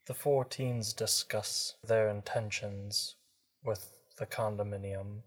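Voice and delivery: ordinary delivery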